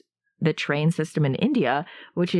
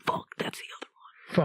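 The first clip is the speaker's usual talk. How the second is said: mock whispered echo; whispering; 7 dB quieter than usual. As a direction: breathe it out in a whisper. Echoes line in whisper.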